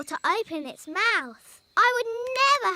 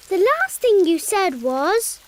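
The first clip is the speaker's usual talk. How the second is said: ordinary talk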